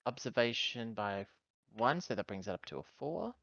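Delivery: ordinary talk, nothing marked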